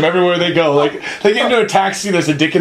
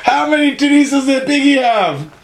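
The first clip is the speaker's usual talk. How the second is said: cackling balcony heckler; Muppet heckler voice; 8 semitones higher than usual. Retold or imitated. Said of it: "They're like".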